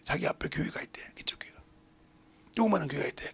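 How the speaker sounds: ordinary delivery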